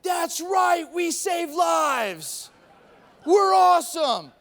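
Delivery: loud, high, stretched-out cheer; full-voiced mock fan roar; triumphant crowd shout. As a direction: bellow it like proud fans. Imitating [name] fans cheering.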